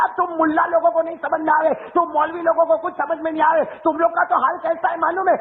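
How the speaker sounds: high-pitched mock angry rebuke; angry young man. Angry young man scolding the preacher, mock high-pitched anger. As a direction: scold the mullahs as a haughty youth, half-hammed. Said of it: "مجھے غصے میں کیا کہتا".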